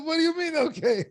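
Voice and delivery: mock the wife's shocked retort; lightly imitated upset wife; upset disbelief. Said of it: "And she was so upset".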